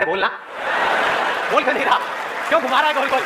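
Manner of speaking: mock-impatient heckler demanding the point; impatient audience. Audience urging the speaker to stop circling, mock impatient. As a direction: voice the crowd's inner impatience. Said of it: "सबके अंदर से आवाज आ रही है".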